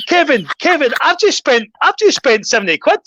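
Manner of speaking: high-pitched mock outrage at Kevin; retold protest. Retold complaint to takeaway owner.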